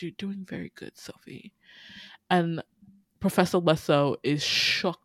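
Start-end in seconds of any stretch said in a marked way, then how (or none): 0.0-1.5 much softer, fading to a breath on the name; a ghostly hush from the swarm; eerie soft voice. Acted. Villain whispering praise to Sophie, eerily soft. The bees speak as Raphael.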